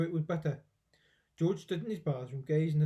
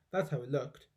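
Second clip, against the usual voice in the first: plain reading voice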